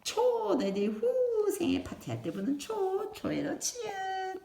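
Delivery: high, sing-song teacher voice; reading to a toddler. Acted. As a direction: coo it to little children. Names object for young kids.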